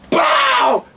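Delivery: a sharp shouted bang like a gunshot; gunshot noise. Retold or imitated. Acting out the shooter firing, shouted bang. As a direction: spit out the gun blast.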